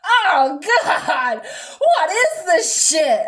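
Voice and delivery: loud, drawn-out mock revulsion; disgusted outburst. Mom after a huge gulp of Sunny D, hammed-up disgust.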